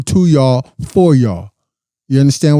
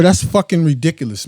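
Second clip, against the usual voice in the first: ordinary talk, nothing marked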